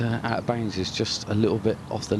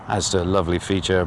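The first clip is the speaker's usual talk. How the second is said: normal talking voice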